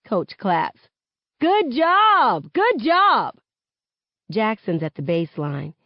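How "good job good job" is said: high loud praise; cheering. A narrator's reading. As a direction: cheer on the players. Coach praising from sideline.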